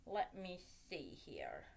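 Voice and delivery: whispered to herself; breathy whisper under breath. Real, not acted. Muttering while wrapping.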